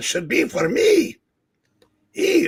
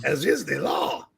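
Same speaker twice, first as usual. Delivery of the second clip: pompous villain; 3 semitones higher than usual. Acted. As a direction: declare it like an arrogant warlord. Reads villain Tarala's lines.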